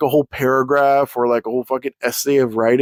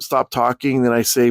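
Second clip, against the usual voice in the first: usual speaking voice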